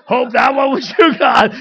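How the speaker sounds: loud cry up to God; shouted appeal. Retold or imitated. Preacher calling out to God, shouted appeal. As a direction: shout it out hard, every word loud.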